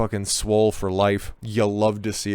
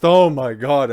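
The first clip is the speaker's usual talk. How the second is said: louder, higher sarcastic outburst; mock shock; 4 semitones higher, 7 dB louder, slower than usual. Host scoffs at reported outrage over cowboys, mock-shocked. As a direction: feign being scandalized.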